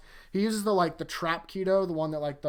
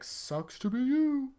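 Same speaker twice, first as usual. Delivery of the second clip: much higher, slow taunting voice; mock villain taunt; 10 semitones higher, slower than usual. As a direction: sneer it down at the loser.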